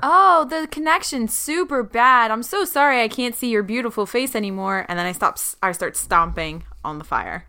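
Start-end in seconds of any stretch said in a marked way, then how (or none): 0.0-4.9 higher-pitched fake regret; sugary insincere sorry, starting high; mock apology. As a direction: feign a bad connection, hammed-up apology. Feigns bad connection to villain.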